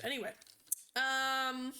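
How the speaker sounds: usual speaking voice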